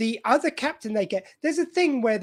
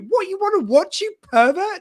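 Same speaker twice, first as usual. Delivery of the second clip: higher-pitched mock outrage; hammed-up accusing question; mock indignant; 8 semitones higher, 4 dB louder than usual. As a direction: retort in imitated indignation, pitch jumping up. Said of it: "and he goes".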